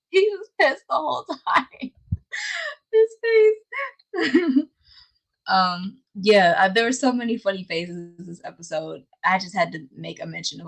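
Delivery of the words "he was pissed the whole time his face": words broken by high laughter; laughing. Real, not acted. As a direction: laugh through the words, almost crying. Laughing while recalling faces.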